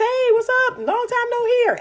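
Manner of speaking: hammed-up smooth returning guy; mock casual guy text. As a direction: tease the man's fake-friendly opener. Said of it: "They text".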